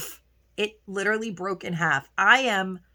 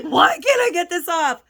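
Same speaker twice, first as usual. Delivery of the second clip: higher, louder retold exasperation, hammed-up; mock frustrated cry of "Why can't I get this off?"; exasperated whine; 7 semitones higher, 4 dB louder than usual. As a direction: replay the moment you lost patience with the cap. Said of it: "I'm like".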